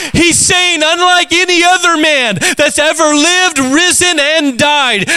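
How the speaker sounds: high, ringing preacher's proclamation; full-force preaching. Acted. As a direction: preach it out to the whole church.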